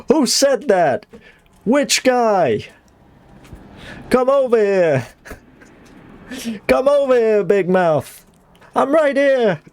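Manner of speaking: high New York challenge; New York accent, calling out. Acted. New Yorker hunting for a big mouth, challenging New York accent. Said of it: "Fine New York accent".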